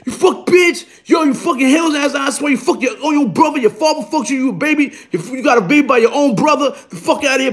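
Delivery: high, ranting mock yell; retold wild yelling. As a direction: re-enact an unhinged tirade of insults. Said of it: "I went wild on this bitch".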